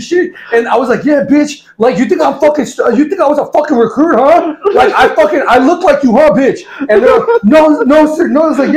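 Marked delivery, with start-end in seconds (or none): 1.1-6.6 high-pitched, laughing mock tirade; retold chewing-out. Instructor confronts recruits who mistook him, mock outrage. 7.4-8.4 high, loud imitation of scared recruits; mock panicked recruits. Caught recruits answer their instructor, mock panic.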